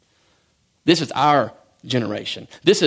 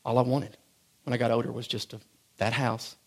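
much softer, lower, intimate; soft personal testimony; quiet confession; 4 semitones lower, 8 dB quieter than usual. Acted. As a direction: drop to a hush and confide in them.

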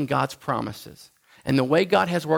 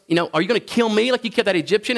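fast, higher accusing taunt; 7 semitones higher, 3 dB louder, faster than usual. Retold or imitated. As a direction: needle him quickly. Israelite taunts Moses.

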